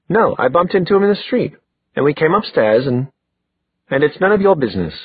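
quick, high defiant retort. Acted. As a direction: fire back defensively, rushing the words. Defiant answer in quarrel.

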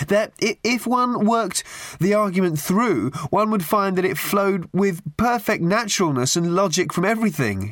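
high-pitched, expostulating youth; insistent. A narrator's reading. Youth to his slumped companion, insistent. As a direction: press the argument in a high voice. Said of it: "he insisted to his thin".

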